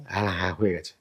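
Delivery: low, hushed reply; acted quiet confirmation. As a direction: drop right down and softly confirm. Acted calm confirmation of finishing.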